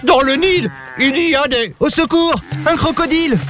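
very high-pitched panicked cries; howling in alarm. A narrator's reading. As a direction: cry for help, pitch shot right up. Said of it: "Hurla Lou".

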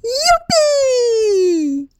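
high, long shout of joy; cried out. Acted. Grand-papa Joe whoops for joy at the ticket, high shout. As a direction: throw the whole voice up in delight. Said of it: "Il jeta les bras en l'air et cria".

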